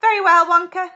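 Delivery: loud, high pompous tone; a rich man showing off his wallet; loud self-important father; importantly. Acted. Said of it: "he said importantly".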